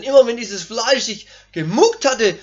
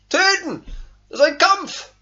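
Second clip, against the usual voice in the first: forceful cry; 7 semitones higher than usual. Real, not acted. Preacher crying out to the congregation about fighting the flesh. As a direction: shout it out as a battle call. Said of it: "preaching climax".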